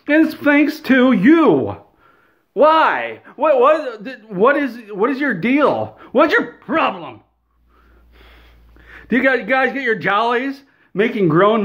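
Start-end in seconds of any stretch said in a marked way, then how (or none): none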